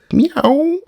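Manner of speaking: very high, loud mock meow; shrill sung-out cat call; cat's meow. A narrator's reading. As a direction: mew like a demanding cat. Cat asked to ask like cat.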